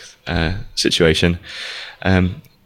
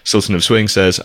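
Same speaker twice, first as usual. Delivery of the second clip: usual speaking voice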